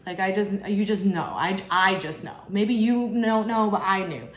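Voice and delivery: normal talking voice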